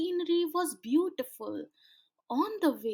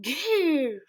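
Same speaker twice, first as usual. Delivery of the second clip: growling roar; 4 semitones higher, 5 dB louder than usual. Acted. Sea guardian roaring at the children, loud growl. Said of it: "roared the seaman".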